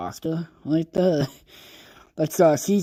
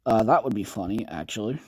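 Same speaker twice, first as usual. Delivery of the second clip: ordinary delivery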